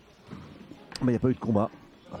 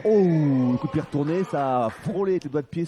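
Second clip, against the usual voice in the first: excited; 5 semitones higher, 4 dB louder than usual. Real, not acted. Commentator reacts to spinning kick.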